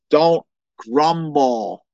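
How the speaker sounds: very slow, weighted scripture quote; slow emphatic command. Real, not acted. Pastor warning the church not to grumble, very slow. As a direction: lean on each word. Quoting verse ten.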